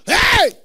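high loud hey; imitating a worshipper shouting. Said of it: "Person shout".

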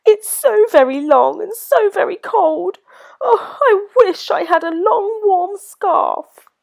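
a forlorn little giraffe; sad giraffe. A narrator's reading. Giraffe complaining to George, high and sad. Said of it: "sniffing sadly".

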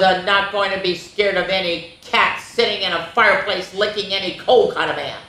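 ordinary delivery

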